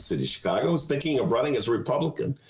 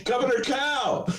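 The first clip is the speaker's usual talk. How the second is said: shouted punchline; 8 semitones higher than usual. Real, not acted.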